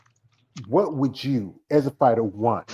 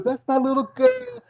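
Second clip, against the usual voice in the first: high-pitched, fretting mother voice; worried mama; 9 semitones higher than usual. Acted. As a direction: plead like an anxious mom. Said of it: "Or your mama says".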